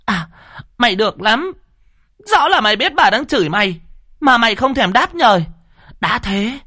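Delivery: screeching curses. Acted. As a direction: scream at the old man with all your spite. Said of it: "ngoạc mồm ra chửi tiếp".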